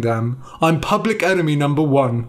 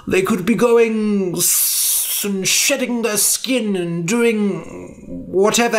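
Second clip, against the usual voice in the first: higher, slow, mock-solemn old wizard; pompous eulogy; 6 semitones higher than usual. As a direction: make up grand words for dead snakes. Elric improvises a funeral speech.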